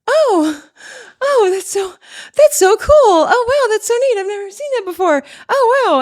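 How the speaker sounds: very high, loud, gushing fake wonder; playing dumb. Her younger self faced with the closing door, high fake-dumb amazement. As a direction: coo like a clueless, over-impressed girl. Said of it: "I would just pretend like I was dumb".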